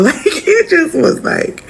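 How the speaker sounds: laughing it out in disbelief, slow and high; a stunned laugh through the words; can't believe it, laughing. Real, not acted.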